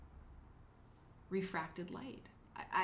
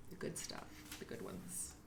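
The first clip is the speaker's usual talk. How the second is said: whispered and much softer, as if sharing a secret; conspiratorial whisper; 11 semitones lower, 12 dB quieter than usual. Real, not acted. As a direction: let the viewers in on the secret in a whisper. Confiding a snack trick.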